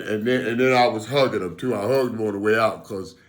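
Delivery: ordinary delivery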